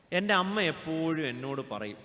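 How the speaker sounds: normal talking voice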